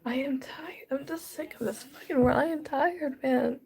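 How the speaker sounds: exhausted and crying, at the end of their rope; real crying. Real, not acted. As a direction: build from a weak, airy start into sobbed protest. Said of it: "I'm sitting here crying".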